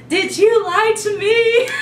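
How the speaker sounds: high, loud mock wail; hysterical sobbing. Calling her friend upset by New Moon, hammed-up sobbing. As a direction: wail the question, imitating tearful hysterics. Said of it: "as she's sobbing hysterically".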